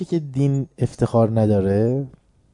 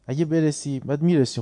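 ordinary talk

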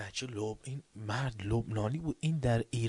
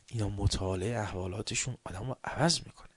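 usual speaking voice

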